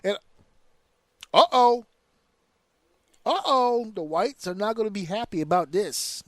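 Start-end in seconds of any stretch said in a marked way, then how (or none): 1.3-3.9 higher, louder teasing uh-oh; sing-song warning that trouble's coming; mock uh-oh.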